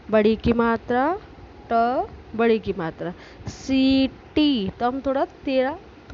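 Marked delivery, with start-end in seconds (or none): 3.5-4.7 सीटी with the ee held long and slow; teacher modelling सीटी, ee drawn out. Shown as a demonstration. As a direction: stretch the ee in सीटी. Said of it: "थोड़ा तेज खींचकर बोल रहे हैं".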